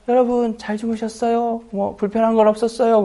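imitated doting, high-pitched concern; sweet with girls. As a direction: fuss sweetly over the girls. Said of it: "왜 여학생들한테는".

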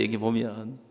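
ordinary delivery

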